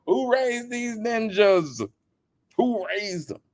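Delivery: high, slow exasperated shout; exasperated demand. Retold or imitated. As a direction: hammer the question in disbelief. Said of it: "I'm like".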